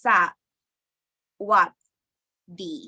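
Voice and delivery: broken up with long pauses between syllables. Shown as a demonstration. Teacher reading out a Thai word for learners, syllable by syllable. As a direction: sound it out in separate syllables. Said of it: "For example, like the word".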